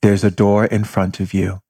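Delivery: ordinary talk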